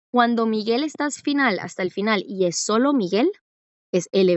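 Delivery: ordinary delivery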